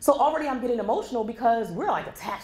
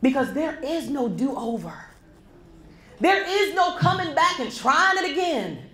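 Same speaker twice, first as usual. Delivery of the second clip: impassioned preaching; 8 semitones higher, slower than usual. Real, not acted. Impassioned closing exhortation.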